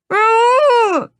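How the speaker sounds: gagged captive almost screaming for help; near scream. A narrator's reading. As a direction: nearly scream the name. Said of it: "I all but scream".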